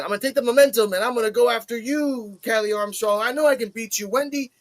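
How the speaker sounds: lightly voiced brash challenge; imitating Kendall. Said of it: "And she says that".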